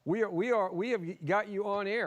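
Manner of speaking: high, loud call down the line. Real, not acted. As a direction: project to a guest on a patchy connection. Calling to guest on remote link.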